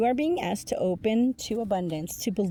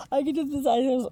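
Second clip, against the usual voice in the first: higher, louder cocky boast; mock cocky; 7 semitones higher, 5 dB louder, much faster than usual. Reader mocking the cocky card figure with a higher boast. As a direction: brag it like a show-off. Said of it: "Somebody's really fucking cocky".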